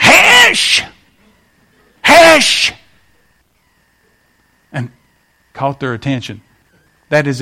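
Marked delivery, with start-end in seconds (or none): 0.0-2.7 much higher, loud sharp 'Hush!'; shouted hush. Retold or imitated. Grandmother shouting over noisy grandchildren, loud. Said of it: "and finally she went".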